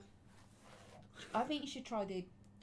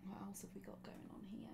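whispering; 11 dB quieter than usual. Real, not acted. Sneaking around his room.

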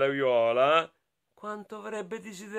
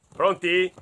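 usual speaking voice

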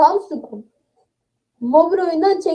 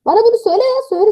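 insistent demand; 6 semitones higher, much faster than usual. Real, not acted. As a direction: demand it from the chat, exasperated. Repeats the demand, exasperated. (daha yüksek ve ısrarcı)

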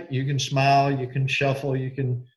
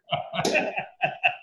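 high breathy laugh; 10 semitones higher, 5 dB quieter than usual. Real, not acted. Laughing at the joke.

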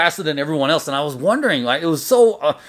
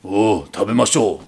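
low, slow voice of the revered master; master's voice; 6 semitones lower than usual. Retold or imitated. Master calling the table to eat, low slow command. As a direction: draw it out low and unhurried. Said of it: "waits for it, and says".

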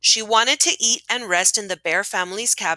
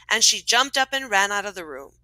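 ordinary talk

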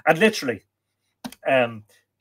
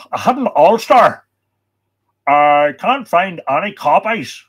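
hammed-up Ulster accent, loud and raised; terrible Ulster accent; 5 semitones higher, 9 dB louder than usual. Host mocking a book search in Ulster, loud bad Ulster accent. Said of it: "That's a terrible Ulster accent".

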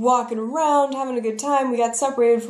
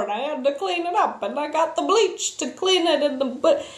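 higher rambling put-on voice of panicked excuses; flustered excuses; 5 semitones higher than usual. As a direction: babble excuses in a funny higher voice. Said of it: "Is that a little Bill Cosby in there? ... what that voice was".

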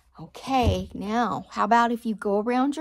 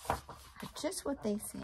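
hushed explanation; 5 semitones higher, 9 dB quieter than usual. Shown as a demonstration. Teacher hushes to children, softly. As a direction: drop to a soft hush.

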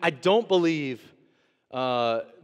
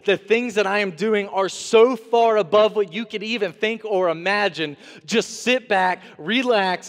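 emphatic promise; 5 semitones higher, 5 dB louder than usual. Acted. God addressing the people through Isaiah.

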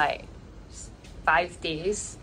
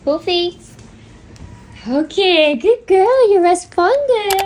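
high, loud sing-song praise for the puppy; puppy talk; 12 semitones higher, 10 dB louder, slower than usual. Real, not acted. Owner praising her puppy in high, loud pet voice. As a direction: gush over the puppy like a proud owner.

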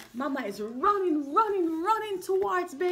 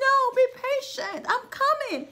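higher, quick soothing mother; calm the child hurriedly; reassuring mama; 6 semitones higher, 3 dB louder, faster than usual. Retold or imitated. Mama llama comforting baby in bed. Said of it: "mama comes up and says".

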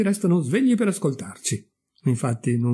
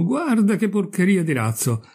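higher-pitched exclamation of scorn; exclaimed disdain; 6 semitones higher than usual. Acted. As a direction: point out some rubbish to your mates. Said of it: "esclamò".

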